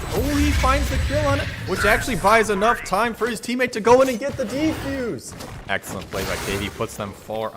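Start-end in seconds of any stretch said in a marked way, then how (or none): 0.1-5.3 excited shout. Real, not acted. Clutch defuse, caster erupts.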